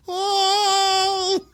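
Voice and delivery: high pained animal howl; half human cry. Retold or imitated. Imitating the wounded creature's high cry. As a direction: wail it like a wounded beast-man. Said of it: "heard a cry that seemed half animal and half human".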